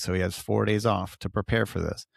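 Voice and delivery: normal talking voice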